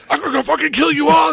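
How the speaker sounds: hammed-up murderous threat; mock Manson rant. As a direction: snarl it like a crazed cult leader. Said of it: "he's like".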